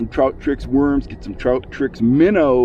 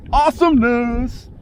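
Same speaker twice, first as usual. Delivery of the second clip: excited; 9 semitones higher than usual. Real, not acted. Fighting a fish.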